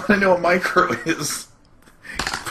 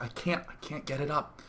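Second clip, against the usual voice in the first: very soft, dismissive mutter; under the breath, disdainful; 3 semitones lower, 14 dB quieter than usual. Acted. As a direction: say it low with quiet disdain. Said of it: "It's like saying with disdain".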